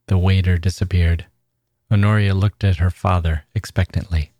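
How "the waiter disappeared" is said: ordinary delivery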